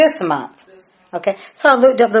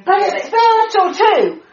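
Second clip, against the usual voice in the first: excited; 11 semitones higher than usual. Real, not acted. Excited preaching aside.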